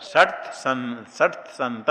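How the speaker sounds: षट संत said very slowly, each sound distinct; slow careful recitation. Shown as a demonstration. Teacher slowly models षट संत for the class. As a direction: spell out षट संत syllable by syllable. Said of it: "क्या कहेंगे?".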